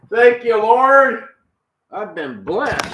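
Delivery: high, loud, slow praise; mock preacher praise. Man thanking the Lord for the clean dishes, hammed-up praise. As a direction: lift it up like a church testimony.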